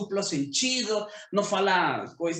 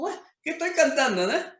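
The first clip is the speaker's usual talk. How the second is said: mock surprise; 8 semitones higher, faster than usual. Catching himself singing a song.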